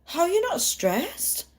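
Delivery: mock amazed; incredulous. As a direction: ask it in stunned wonder. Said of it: "I'm like".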